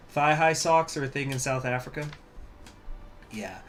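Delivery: ordinary talk, nothing marked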